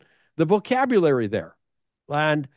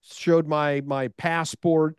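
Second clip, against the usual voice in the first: ordinary talk, nothing marked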